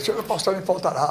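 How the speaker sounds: soft, quick, half-hearted reading; mock mumbled recital. Mocking a churchgoer's rote reading, softly. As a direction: rattle off the psalm without belief. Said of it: "Aí você lê o Salmo".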